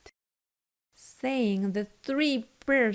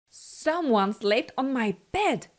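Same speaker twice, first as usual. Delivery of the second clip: ordinary delivery, nothing marked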